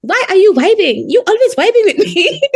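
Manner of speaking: high, singsong mock complaint breaking into laughter; imitating quarrelsome colleague. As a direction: whine it high, playfully imitating her in a fight. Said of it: "who always gets in a fight, she say".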